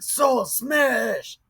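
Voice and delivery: lumbering Hulk shout; Hulk-style Saul. Retold or imitated. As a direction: shout it like a brute hero. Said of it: "He was like".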